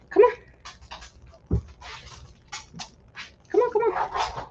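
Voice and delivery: high, breathy coaxing to a pet. Real, not acted. Owner coaxing her nosy pet away, high coaxing voice. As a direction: coo to the dog to come.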